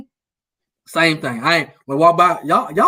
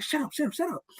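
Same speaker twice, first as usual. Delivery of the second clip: much softer, hushing his friends fast; hushed shut up; 5 semitones higher, 15 dB quieter than usual. Retold or imitated. To homeboys who caught his trolling, a hushed shut up. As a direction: shush the homeboys so nobody hears. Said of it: "I'm like".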